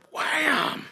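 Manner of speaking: high, loud, drawn-out exclamation; sudden strike. Retold or imitated. Angler telling of a sudden bite, excited burst. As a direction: burst out as the fish hits.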